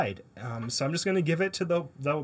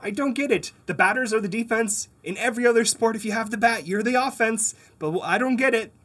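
exasperated rant; 7 semitones higher, 6 dB louder than usual. Real, not acted. Exasperated rant about cricket rules.

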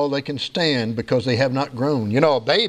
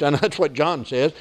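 normal talking voice

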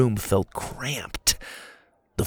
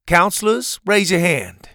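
loud, higher roll call; 8 semitones higher, 4 dB louder than usual. Acted. Pa calls on the counselors in the crowd. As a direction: call for the counselors to raise hands. Addressing the whole group.

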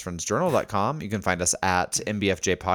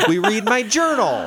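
mock announcement; 11 semitones higher, 6 dB louder than usual. Mock self-absorbed show pitch.

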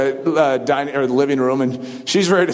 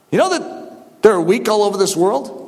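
ordinary delivery

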